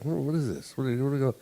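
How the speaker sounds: soft, puzzled muttering under the breath; half-voiced confusion, mumbled. Friend Jay staring around the room, quietly puzzled. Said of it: "he's like".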